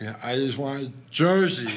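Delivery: imitating a slurred, drowsy mumble; groggy from anesthesia. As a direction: lightly mimic a dazed, drugged voice. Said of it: "he is still gone".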